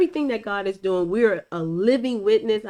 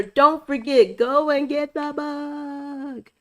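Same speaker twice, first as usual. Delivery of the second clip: high, very slow call-out to listeners; long, raised, lingering plug; drawn-out plug; 6 semitones higher, much slower than usual. Real, not acted. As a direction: stretch it out like an announcer's hook.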